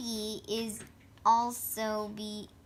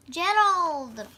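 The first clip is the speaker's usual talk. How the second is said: ordinary delivery, nothing marked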